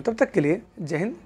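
ordinary delivery

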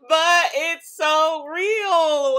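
shrieking through helpless laughter, slowed; real laughing wail; laugh-crying. Real, not acted. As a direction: crack up while forcing the words out. Laughing hard at a meme.

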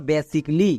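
"বেসিক লি" in two parts, "be-sik, li"; silent-a pronunciation. Shown as a demonstration. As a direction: say "বেসিক লি" with no vowel between k and l. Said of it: "মানে পুরোটা কি দাঁড়ালো?".

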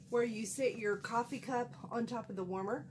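ordinary talk

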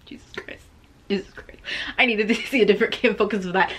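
normal talking voice

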